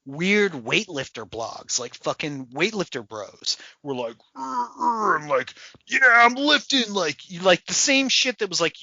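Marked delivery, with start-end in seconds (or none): 4.4-5.2 high strained grunts; mock weightlifter grunts; mock lifting grunt. 5.9-6.9 high loud pumped bro voice; loud, pumped mock gym bro. Weightlifter bro voice.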